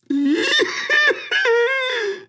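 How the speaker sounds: a sudden high laugh bursting out; surprised laugh. Acted. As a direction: laugh out loud in shocked recognition. Father recognizes his lost son.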